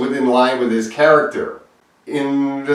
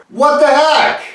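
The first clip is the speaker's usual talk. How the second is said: higher, fed-up what the heck; exasperated; 12 semitones higher, 3 dB louder than usual. Real, not acted. Fan baffled by Zack Snyder's choice, exasperated. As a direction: throw up your hands. Exasperated over the missing Booyah.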